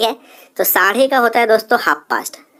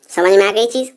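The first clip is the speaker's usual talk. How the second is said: usual speaking voice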